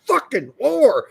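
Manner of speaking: furious high-pitched yell at a pan; angry yelling. Retold or imitated. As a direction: scream abuse at the dirty pan. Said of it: "I was getting fucking pissed off".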